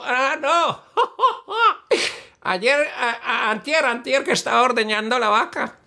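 high fool's voice breaking into laughs; high silly Bobo voice. Acted. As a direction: play the giggling fool. (bobo con voz aguda y risueña)

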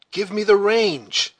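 loud, high, drawn-out call; urgent call. A narrator's reading. Hawkeye calls out in confusion.

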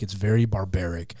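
usual speaking voice

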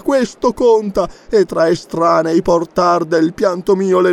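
high, sing-song declamation; melodramatic poem recital. A narrator's reading. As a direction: recite it like an overwrought schoolgirl poetess. Narrator declaims a schoolgirl's poem.

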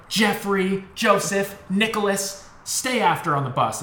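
high, sharp name-by-name callout; mock teacher yell. Teacher singling out kids in front of the whole bus, mock yelling. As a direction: imitate a teacher calling out culprits. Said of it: "she yelled at us".

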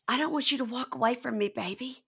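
mock her clingy begging; imitating Lacey. Lacey touching his face and pleading, mock whine. Host voices Lacey again.

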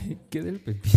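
high, loud, fast through laughter; laughing question. Real, not acted. Co-host asks about the cucumber story, laughing. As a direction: crack up while asking.

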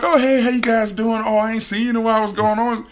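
high, chirpy-adult hello; imitated friendly greeting. Greeting kids out of the car, high imitated friendly hello. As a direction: pitch it way up, overfriendly.

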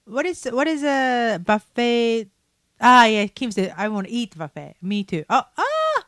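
normal talking voice

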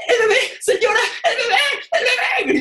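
shouting for the mother. Retold or imitated. Calling to the mother from the moving float, laughing yell. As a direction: call after the mother in a panic, half laughing.

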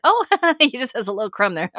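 loud, fast, laughing fuss; fussing over a crumb; mocking panicky grandma. Grandma fussing over the baby, a loud mock fuss. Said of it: "Just like".

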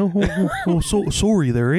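hint of a polite Canadian accent; nice Canadian voice. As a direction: apologize like a meek, stammering Canadian. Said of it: "Your nice voice is always Canadian".

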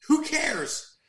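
a high, laughing, fast scoff. Real, not acted. Mocking American indifference to Japan.

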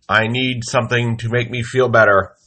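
ordinary talk

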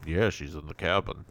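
lightly voiced low character. Recapper voices one side of the cabin exchange, low character voice. Voicing two characters arguing.

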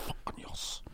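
breathy, voiceless whisper of triumph; a whispered fist-pump; whispered yes. Real, not acted. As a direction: hiss a quiet yes to yourself. Quiet celebration at right answer.

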